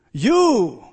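You flung out loud; sudden loud You. Real, not acted. Preacher accusing listeners directly, loud burst. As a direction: punch You hard.

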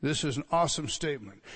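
ordinary talk

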